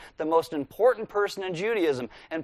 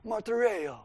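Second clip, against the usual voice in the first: higher, softer mock mobster accent; Godfather accent; 4 semitones higher, 5 dB quieter than usual. Preacher playfully showing how to say the Greek word, Godfather accent. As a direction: put on a Godfather-style accent. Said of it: "like, a Godfather kind of accent".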